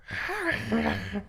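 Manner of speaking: high creaky turtle croak; turtle impression. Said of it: "That is my turtle impression".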